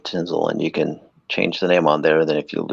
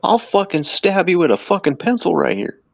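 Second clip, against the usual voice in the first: higher-pitched hammed-up threats at the clerk; imagined customer threatening a cashier, lightly raised mock anger; mock angry customer; 8 semitones higher than usual. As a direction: keep the put-on shopper voice going, a bit higher. Continues the imagined coupon rant.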